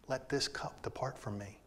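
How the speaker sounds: a whispered prayer of anguish; hushed whispered prayer. Acted. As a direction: whisper it like a plea in the dark. Jesus's prayer in the garden.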